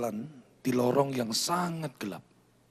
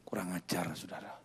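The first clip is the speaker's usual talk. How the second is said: indignant whisper; 9 semitones lower, 11 dB quieter than usual. Real, not acted. Indignant aside after the insult.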